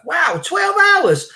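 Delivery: high, drawn-out slow wow of amazement; squealing mock wonder. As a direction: play it up as if twelve hours is astounding. Mock amazement at long broadcast.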